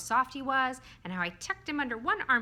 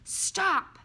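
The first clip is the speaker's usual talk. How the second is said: high, loud shout to halt; sharp shouted command; 12 semitones higher, 8 dB louder than usual. Acted. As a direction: cry out to make someone stop. Bob suddenly halts the laughing narrator.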